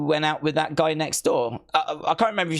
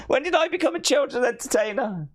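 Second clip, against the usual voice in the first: mock despair; 10 semitones higher than usual. Repeats the complaint.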